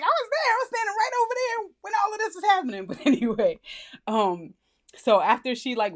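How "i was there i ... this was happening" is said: much higher, fast gushing brag; giddy self-imitation, pitched way up; excited. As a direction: blurt it out thrilled and fast. Retelling her own excitement.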